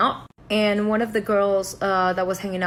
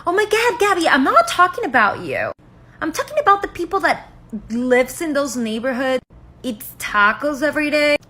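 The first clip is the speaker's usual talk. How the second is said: much higher, louder imitation; mock exasperated girl; 6 semitones higher, 5 dB louder than usual. Girl excuses her remarks to Gabby, mock exasperated. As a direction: protest in a high, loud put-on voice.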